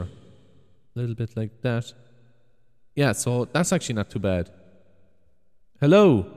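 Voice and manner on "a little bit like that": softer, slower test take into the mic; quieter. Shown as a demonstration. Testing the new mic in a big room, quieter. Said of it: "Maybe a little bit quieter".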